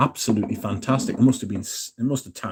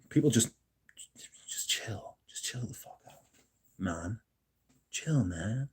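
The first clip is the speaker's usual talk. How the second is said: barely voiced, half-whispered "just chill the fuck out"; almost whispering, telling everyone to calm down; hushed chill out; 11 dB quieter than usual. Real, not acted.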